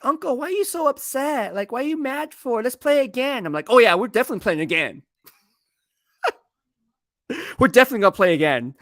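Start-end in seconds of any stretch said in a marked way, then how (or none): 0.0-3.5 high-pitched mock little girl; imitating young niece. Niece asks her upset uncle to play again, imitated in a high child voice. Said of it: "she's like, six or seven". 3.7-8.7 fast, loud, hammed-up sore loser; mock competitive upset. Uncle answers niece after losing a board game, comically competitive. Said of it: "I'm so competitive like that".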